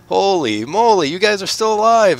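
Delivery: high, loud, astonished greeting; amazed. Acted. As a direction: sound amazed to see them still alive. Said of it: "He goes".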